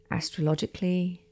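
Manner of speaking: ordinary talk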